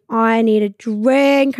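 slow, stretched longing; drawn-out craving. Retold or imitated. In the lounge, slow and stretched, craving a drink. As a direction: drag the words out like you're desperate.

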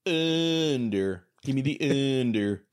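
drawn-out vowels in a put-on Baltimore accent; mock Baltimore accent. Panelist picking the under, mimicking the host's Baltimore accent. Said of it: "is that the Baltimore accent way of saying under".

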